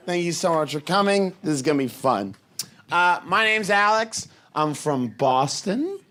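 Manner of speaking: ordinary delivery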